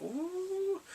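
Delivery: high, sing-song teasing ooh. Retold or imitated. Vlogger teasing viewers about a steamy scene, sing-song. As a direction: tease like a gossiping friend. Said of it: "I'm like".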